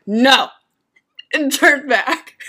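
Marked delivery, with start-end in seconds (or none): 0.1-0.5 curt no. Retold or imitated. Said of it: "I'm like".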